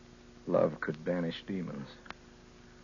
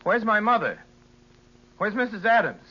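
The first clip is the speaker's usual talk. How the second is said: higher and louder, frantic questions; rising panic; 11 semitones higher, 8 dB louder than usual. Acted. Frightened son calling home for his mother, rising panic. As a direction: demand answers as fear takes over.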